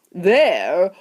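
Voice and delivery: slow, high, drawn-out announcement; grand reveal. A narrator's reading. Wonka revealing who the little people are, grand announcement. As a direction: stretch the name out like a showman.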